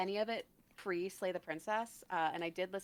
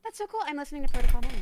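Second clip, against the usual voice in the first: much higher, thrilled burst; real excitement, higher and then louder; excited; 7 semitones higher, 12 dB louder, much faster than usual. Real, not acted. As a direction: squeal it out with delight. Thrilled reaction.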